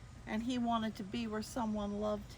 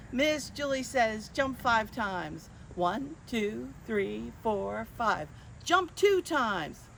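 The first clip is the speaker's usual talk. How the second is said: higher, bouncy game-caller voice; game leader; 5 semitones higher, 3 dB louder than usual. Acted. Miss Julie calling commands to children, playful. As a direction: keep the kids guessing. Leads Simon Says game.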